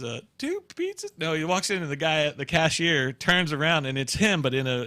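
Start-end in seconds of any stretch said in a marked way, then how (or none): 0.4-1.1 high squeaky ad voice; squeaky question. Retold or imitated. Man in the pizza ad asking in a high squeak. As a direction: squeak it like a cartoon. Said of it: "he goes".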